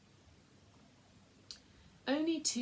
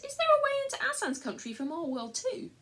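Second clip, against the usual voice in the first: higher and louder, bursting with hope; eager surprise; 13 semitones higher, 7 dB louder than usual. Acted. Edmund asks the lamb in excited surprise. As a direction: blurt it out, amazed there might be a way.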